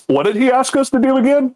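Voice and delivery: high, dazed and foggy; spaced-out producer. Retold or imitated. Mocking a studio exec for his co-host, high and dazed. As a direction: play a coked-up exec losing track. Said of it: "Just like".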